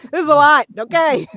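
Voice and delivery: laughingly retold overwhelm; overwhelmed reaction. Retells overwhelmed reaction to practice.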